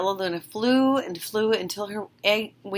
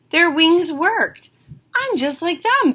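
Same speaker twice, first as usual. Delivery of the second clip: excited; 10 semitones higher, 6 dB louder than usual. Acted. Stella thinks excitedly.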